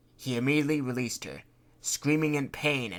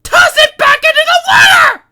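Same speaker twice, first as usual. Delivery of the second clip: much higher and louder frantic scream; 24 dB louder than usual. Acted. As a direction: shriek in panic. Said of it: "she frantically said".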